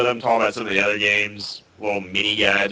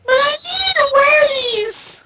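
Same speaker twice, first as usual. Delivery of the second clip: put-on high, crying whiny child voice; whiny kid; slower than usual. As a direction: whine it like a little kid. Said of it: "talking like a seven-year-old kid".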